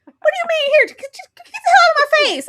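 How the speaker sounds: high, loud mock screech; shrieky retold quarrel, tumbling fast; acted outraged yell. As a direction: rush it out like a furious partner in a reenacted fight. Acting out a couple's quarrel.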